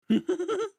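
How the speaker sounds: sudden high-pitched giggle; squeaky burst of laughter. Real, not acted. Laughs after own line.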